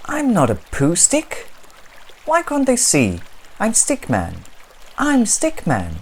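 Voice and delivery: high, loud pleading protest; exasperated little stick figure; frustrated Stickman. Acted. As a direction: insist on who you are.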